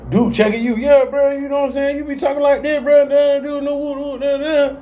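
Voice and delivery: mock a hypocrite brushing off criticism; high squeaky mock of a defensive buddy.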